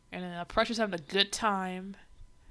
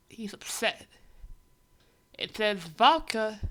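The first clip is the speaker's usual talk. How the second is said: normal talking voice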